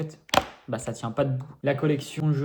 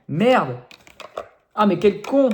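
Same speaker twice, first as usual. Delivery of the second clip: loud exasperated swearing; annoyed at himself; 6 semitones higher, 9 dB louder, slower than usual. Real, not acted. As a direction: curse your own blunder, loud. Cursing own mistake.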